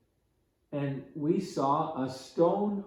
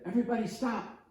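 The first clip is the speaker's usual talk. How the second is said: higher, urgent call to stop; sudden halt call; 8 semitones higher, much faster than usual. Retold or imitated. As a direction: freeze the group in its tracks. Counselor halts the group suddenly.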